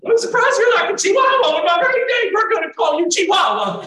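very high, loud Texas teasing; great Texas accent. Retold or imitated. Texas neighbor mocking the basketball player, shouted Texas accent. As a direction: holler it in a mock Texas lady's voice. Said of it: "in her great Texas accent".

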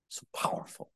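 breathy hushed whisper. Acted. Preacher to the congregation, awed whisper. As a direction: whisper it in awe.

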